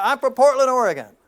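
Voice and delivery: high, loud blurt. Retold or imitated. Dream sailor suddenly answering where he's from, loud blurt. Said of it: "And he blurts out".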